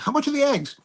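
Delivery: half-playing the shopper's question; customer asking. Retelling a customer at market.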